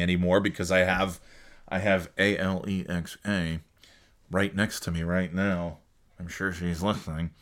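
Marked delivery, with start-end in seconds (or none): 1.7-7.3 lowered, softer voice; guarded, hushed. Real, not acted. Podcaster spells the smart speaker's name as she listens, guarded and hushed. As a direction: keep it down so the device won't hear.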